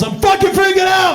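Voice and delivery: high, loud panicked cry; imitating a freaked-out brother; panicked yell. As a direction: shout it like he's losing it. Panicked brother's text voiced.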